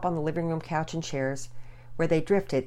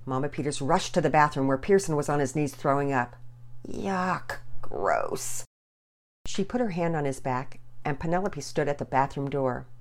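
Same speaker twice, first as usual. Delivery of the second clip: ordinary delivery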